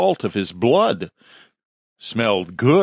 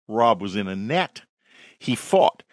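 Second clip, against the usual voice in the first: usual speaking voice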